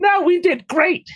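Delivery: high, lightly put-on hero; squeaky character voice. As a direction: play the small hero cheerfully. Plays character in voice.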